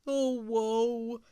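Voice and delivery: mopey groan; groaning Walrus. Acted. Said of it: "groaned Walrus".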